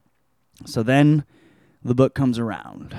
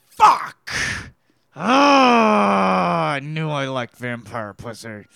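mock anguished yell; 4 dB louder, much slower than usual. Neville reading the vampire's letter, hammed-up anguished yell. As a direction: wail it like a tortured hero.

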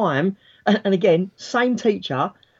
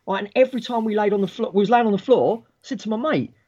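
ordinary talk, nothing marked

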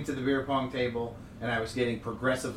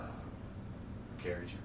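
soft low deadpan answer; flat drone, barely voiced; monotone voice; 5 semitones lower, 13 dB quieter, much slower than usual. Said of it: "he's got that monotone voice".

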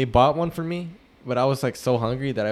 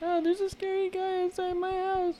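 put-on much higher, softer scared child's voice; little girl on the phone; 7 dB quieter than usual. Retelling a creepy phone call, high soft child's voice. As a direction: squeak it like a frightened little girl calling. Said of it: "there's a phone call where she's like".

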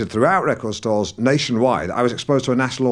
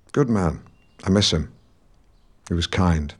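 tag; 6 semitones lower than usual. Acted. Mourning his late friend.

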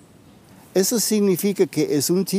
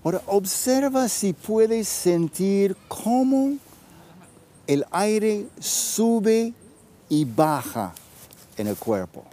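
right in your ear. Acted. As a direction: guide them gently inward, with long pauses. Leads a guided breathing practice.

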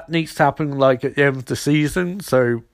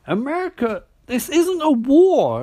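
high, drawn-out incredulous protest; mock disbelief; 10 semitones higher than usual. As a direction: object in an exaggerated high voice. Retold objection to Eiling's patriotism.

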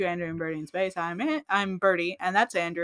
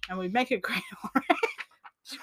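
whispered laugh; 4 dB quieter, slower than usual. Real, not acted. Giggling whisper repeat.

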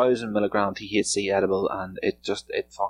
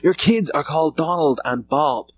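a higher, mock-Irish McGregor taunt, slowed down; imitating McGregor; 7 semitones higher, 4 dB louder than usual. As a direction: jab at him like McGregor at the press conference. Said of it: "he's like".

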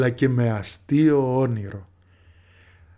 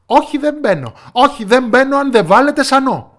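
loud, high, whining refusal; stubborn daughter; 12 semitones higher, 8 dB louder than usual. A narrator's reading. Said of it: "έλεγε η κόρη". (πεισματάρα κόρη, δυνατή ψηλή άρνηση)

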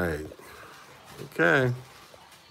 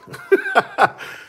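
laugh; 6 dB louder than usual. Real, not acted. Laughing at cousins' slang.

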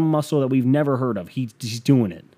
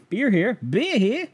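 much higher, playful chant; sing-song; 11 semitones higher, slower than usual. Pretend. Vlogger singing out beer here, much higher. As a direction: sing it out like a stadium vendor.

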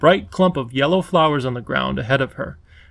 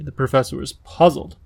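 plain reading voice

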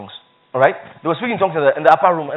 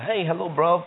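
put-on UK greeting accent; 2 semitones lower, 8 dB quieter, slower than usual. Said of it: "you know the accent".

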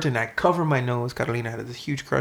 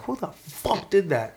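slow, high-pitched mock outrage; indignant retort; 7 semitones higher, slower than usual. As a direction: act out an indignant "who did this?". Retold reaction to snowball hit.

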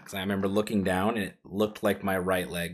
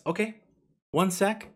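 paramedic's calm okay; 11 semitones higher than usual. Retold or imitated. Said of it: "And he goes".

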